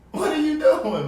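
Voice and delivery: high, loud, exasperated rebuke; rebuking shout. Acted. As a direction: call him out across the street. Preacher rebuking the dope seller.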